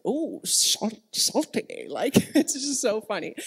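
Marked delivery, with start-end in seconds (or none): 0.0-1.9 lightly voiced lisping sloth, lips puckered; puckered lips, a mild cartoon sloth; Sid the Sloth voice. Imitating Sid tasting seawater, puckered sloth voice. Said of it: "puckers his lips and goes".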